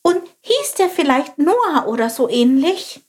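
high, curious little bird voice; little sparrow voice. Acted. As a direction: chirp it like a small inquisitive sparrow. Chilp asks eagerly. (hohe, neugierige Spatzenstimme)

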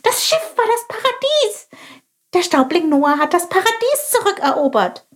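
very high, thrilled exclamation; excited cry. Acted. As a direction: burst out with delight. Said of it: "rief Chilp aufgeregt". (aufgeregter, sehr hoher Ausruf)

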